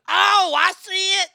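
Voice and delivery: hammed-up imitation of sudden understanding; imitating her realizing. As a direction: stretch it out as the penny drops. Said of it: "she goes".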